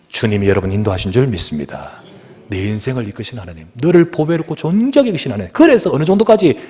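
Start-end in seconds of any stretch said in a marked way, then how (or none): none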